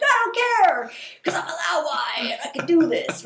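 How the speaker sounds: high, loud defiant shout with a cry in it; yelling back. Retold or imitated. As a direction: shout back stubbornly, near a scream. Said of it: "And I'm yelling back".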